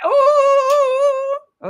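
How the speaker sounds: high, loud, drawn-out "ooh"; triumphant whoop. Real, not acted. Host seeing she was right, triumphant whoop. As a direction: whoop long and high in victory.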